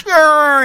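high revving ambulance growl; siren growl. Acted. Girl racing the hurt cherry away, ambulance growl. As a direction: rumble like a toy ambulance.

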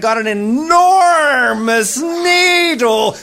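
high, hugely stretched words; hyped exaggeration. A narrator's reading. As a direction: blow up the size with long vowels. Narrator exaggerates the huge needle.

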